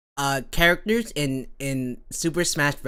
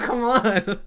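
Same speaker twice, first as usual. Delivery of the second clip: ordinary talk, nothing marked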